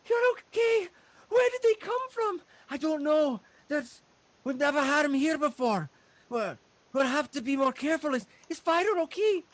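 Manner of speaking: very high, urgent voices; worried halfling family. Acted. Halfling parents checking on each other, worried. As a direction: fret in a high halfling pitch.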